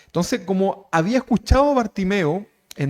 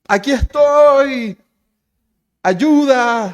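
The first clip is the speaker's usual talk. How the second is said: loud, high, drawn-out call; calling for help; 8 semitones higher, 7 dB louder, much slower than usual. Acted. Bartimeo signals where he is, calling out for help. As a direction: holler to be noticed, stretching the vowels.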